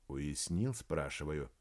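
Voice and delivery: low, soft menacing repeat. Acted. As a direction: press the question softly and coldly. Maxim repeats the threat. (тихо и угрожающе)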